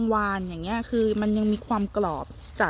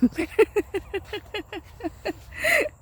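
high squealing laugh; 12 semitones higher than usual. Real, not acted. Vlogger laughing at the assistant's joke, high squeal. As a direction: laugh bursting up high with a crying edge. Assistant jokes about cutting hand.

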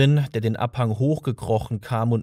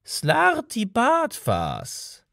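gentle repeat; 12 semitones higher, much slower than usual. A narrator's reading. Old man gently repeating his name to Arthur, very slow. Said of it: "wiederholte der Alte sanft".

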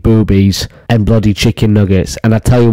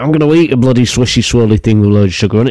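fast rant; faster than usual. Real, not acted. Ranting to viewers that he'll eat what he wants, fast. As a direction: repeat it faster, rattling the words off. Ranting repeat of his demand.

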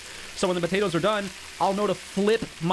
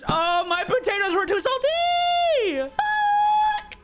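slow, climbing wail of dismay; dismayed outburst; 3 dB louder, slower than usual. Real, not acted. Gamer hears the potatoes were too salty, dismayed high wail. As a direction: voice jumps high and loud on the swear.

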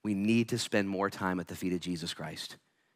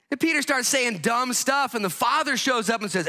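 1 preaching at full force; 14 semitones higher, 5 dB louder than usual. Real, not acted.

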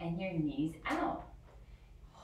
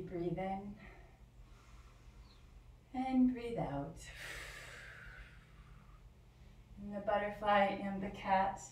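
guided relaxation; 4 dB quieter than usual. Acted. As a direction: ease the children into stillness. Guided rest at the end.

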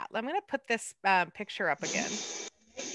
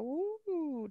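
soft, high "woo" gliding up and down; swooping "woo"; 7 semitones higher, 9 dB quieter than usual. Shown as a demonstration. Teacher showing the cat's arched back, a swooping woo.

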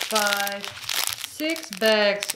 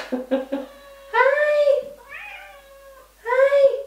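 much higher, cooing hello to the kittens; sweet pet-talk greeting, pitched way up; 14 semitones higher than usual. Real, not acted. Greeting her cats.

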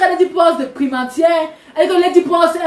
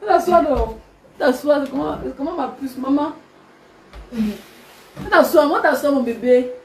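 quiet, tender hush to a little one; 4 semitones lower, 5 dB quieter than usual. Real, not acted. Mother quietly settling her baby mid-live, tender.

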